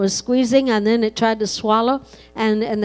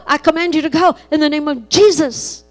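commanding rebuke; 5 semitones higher, 5 dB louder than usual. Retold or imitated. Older sister ordering the python off her little sister, building to a shout. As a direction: rebuke the snake, rising to a shout on Go. Girl commands snake to leave.

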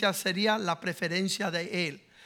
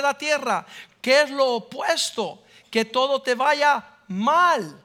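full-force preaching; 3 semitones higher, 4 dB louder than usual. Acted. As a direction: drive each word of the verse home.

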